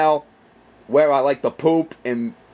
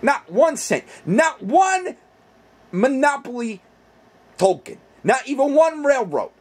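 indignant building; 9 semitones higher than usual. Acted. Escalating complaint about pay.

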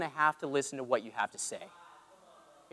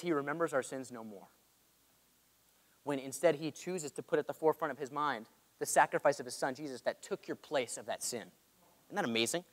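quiet tender; 4 semitones lower, 11 dB quieter than usual. Real, not acted. Tender hush about forgiveness.

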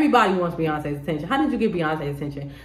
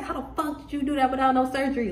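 higher, softer incredulous question; lightly mock disbelief, pitched up; imitated amazement; 5 semitones higher, 5 dB quieter than usual. Onlookers asking about your new body, mock amazement.

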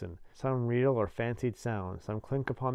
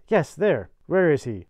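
loud, higher, urgent demand; urgent exclamation; 5 semitones higher, 10 dB louder, slower than usual. A narrator's reading. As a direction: demand it as a roused convict. Convict urgently demands man's whereabouts.

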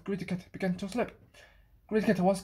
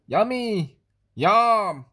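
greedy yum; 7 dB louder, much slower than usual. Acted. Cat's greedy thought, voiced.

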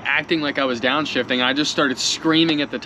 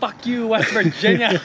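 mock shout; 7 semitones higher than usual. Retelling the shout out window.

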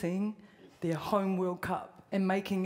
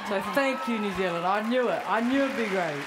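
excited; 5 dB louder than usual. Real, not acted. Loud thanks to New Zealand.

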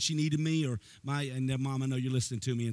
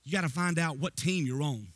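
ordinary talk, nothing marked

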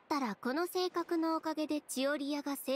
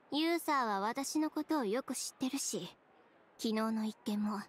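normal talking voice

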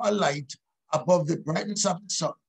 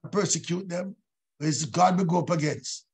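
normal talking voice

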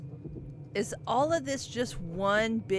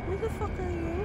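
startled; 7 semitones higher than usual. Real, not acted. Startled by sudden stranger.

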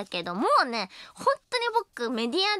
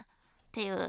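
normal talking voice

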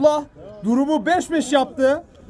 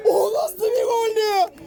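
amazed shout; 7 semitones higher than usual. Real, not acted. Amazed goal reaction.

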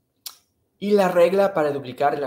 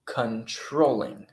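slow demo; much slower than usual. Shown as a demonstration.

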